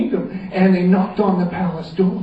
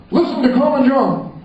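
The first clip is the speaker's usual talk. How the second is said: king command; 8 semitones higher, 4 dB louder than usual. Acted.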